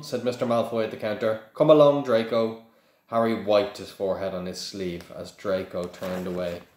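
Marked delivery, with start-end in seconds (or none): 1.5-2.6 a firm, louder call to the son. Acted. Father calling his son from the counter, firm and louder. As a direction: summon the boy sharply.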